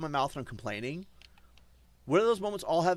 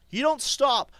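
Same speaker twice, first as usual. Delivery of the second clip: loud, higher, heated rant; angry outburst; 7 semitones higher, 6 dB louder, slower than usual. Real, not acted. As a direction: really let them have it. Ranting at his chat.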